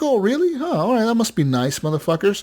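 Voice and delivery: sarcastic reply to the cable rep. Retold or imitated. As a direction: fake amazement that drips with sarcasm.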